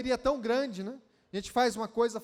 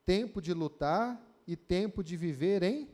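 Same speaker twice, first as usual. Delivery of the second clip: lower, measured Bible reading; scripture reading; 5 semitones lower than usual. Acted. Reads Ecclesiastes verses aloud.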